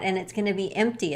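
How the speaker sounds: ordinary talk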